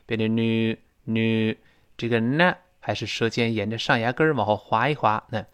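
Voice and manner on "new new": American "new" with barely any y, noo; slow American "new", the glide almost gone; American pronunciation. Shown as a demonstration. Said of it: "美音呢，这个耶就几乎听不清了".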